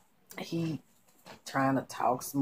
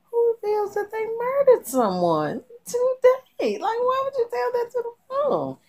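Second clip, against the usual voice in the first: incredulous squeal; 5 dB louder than usual. Real, not acted. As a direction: wail it in exasperated disbelief. Outraged at a confession.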